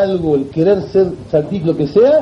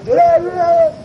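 imitated crying; 11 semitones higher than usual. Storyteller imitates the young man starting to cry, mock wailing. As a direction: mimic someone bursting into tears. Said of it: "se puso a llorar".

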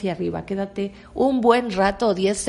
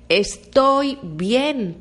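slow affirmation; 3 semitones higher, much slower than usual. Shown as a demonstration. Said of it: "quiero que digas".

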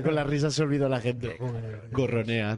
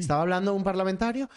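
ordinary talk, nothing marked

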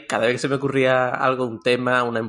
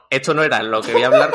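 normal talking voice

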